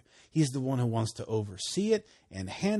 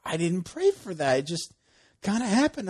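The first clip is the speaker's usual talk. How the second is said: high, excited disbelief; amazed; 9 semitones higher, 4 dB louder than usual. Retold or imitated. Friend marveling that healing came unasked, amazed. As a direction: blurt it in astonishment. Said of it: "he's like".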